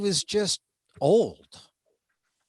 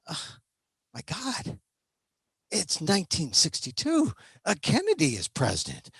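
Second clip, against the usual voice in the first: ordinary delivery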